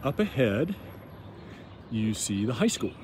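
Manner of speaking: ordinary talk, nothing marked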